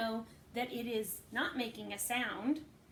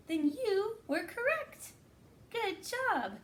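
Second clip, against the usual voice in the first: praise; 9 semitones higher, slower than usual. Real, not acted. Praising children.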